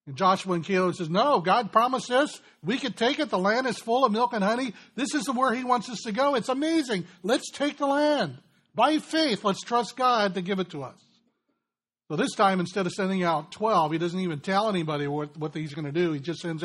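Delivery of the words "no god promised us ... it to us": rally the people to take the land; excited faith. Acted. Joshua and Caleb rallying Israel, rising excited plea. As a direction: voice climbing higher and louder, building urgency.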